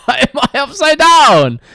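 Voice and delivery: high, loud surprised cry; startled yelp. Real, not acted. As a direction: blurt it out in sudden alarm. Sudden confusion at the game view.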